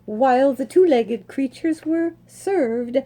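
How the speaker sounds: lively read-aloud storytelling voice; story narration. A narrator's reading. Reading a horse story aloud to listeners, lively storytelling. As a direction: read it like a picture book to children, bright and lilting. Reads picture book aloud.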